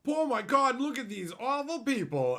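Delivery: outraged fans, hammed up; mock outrage. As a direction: play the scandalized crowd, gasping. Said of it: "and they go".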